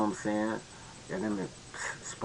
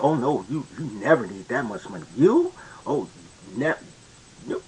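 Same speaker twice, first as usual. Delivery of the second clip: mock dismissive woman; 6 semitones higher, 6 dB louder than usual. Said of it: "they'll immediately be like".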